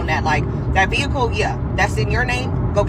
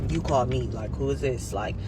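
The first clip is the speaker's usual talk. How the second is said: low, quieter man on the phone; man's voice; 8 semitones lower, 8 dB quieter than usual. Retold or imitated. Man on phone snaps back at the caller, lower and softer. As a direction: drop down into the guy's voice.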